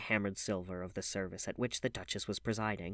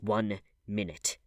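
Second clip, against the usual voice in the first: slow deliberate; slower than usual. Acted.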